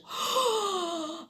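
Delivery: startled, breathy gasp; sharp gasp. Acted. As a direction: gasp as if struck by what you see. Spotting clothes outside the store.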